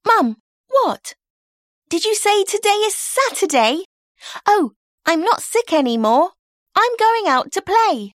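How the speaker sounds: put-on excited girl ready to play; sudden excited girl. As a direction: burst into delight, suddenly well. May hears it is Saturday.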